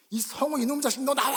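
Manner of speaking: mother scolding her son at the door; angry yell. Retold or imitated. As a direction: call the boy out like an angry mother. Imagined mother yelling.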